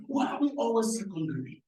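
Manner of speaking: ordinary talk, nothing marked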